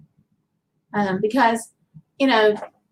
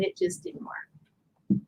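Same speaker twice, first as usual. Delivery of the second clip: whisper; 10 dB quieter, much slower than usual. Real, not acted. Deflated end of a failed attempt.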